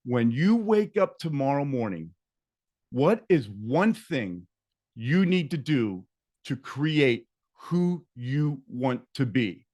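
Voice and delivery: measured question with pauses between phrases; slow reading. Shown as a demonstration. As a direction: read it slowly, phrase by phrase. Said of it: "I'm gonna read it slowly".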